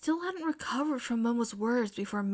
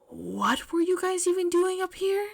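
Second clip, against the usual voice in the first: baffled; 8 semitones higher than usual. A narrator's reading. Teacher baffled.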